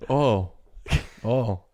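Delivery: OIL squashed to "ohl", loud; OIL sounding just like OH; dialect oil. Shown as a demonstration. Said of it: "That's a noise. That's not a word".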